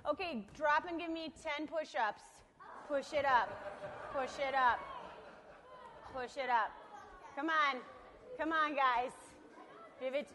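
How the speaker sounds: loud barked drill orders; drill sergeant. Pretend. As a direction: bark commands like a boot camp sergeant. Shouting exercise orders at children.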